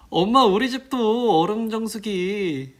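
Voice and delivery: put-on high, loud, slow kid call; excited child. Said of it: "애기들".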